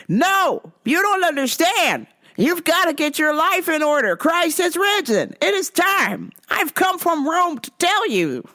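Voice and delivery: mock zealot lecturing a cashier; preachy Rome guy. Man insists.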